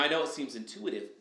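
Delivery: usual speaking voice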